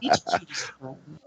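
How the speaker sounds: put-on sweet-talking in a Kentucky accent. Radio guest to hosts, teasing in a Kentucky accent. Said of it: "That's the Kentucky coming out".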